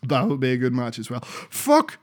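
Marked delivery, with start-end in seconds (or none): 1.2-2.0 sudden remembering. Real, not acted. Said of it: "Almost forgot".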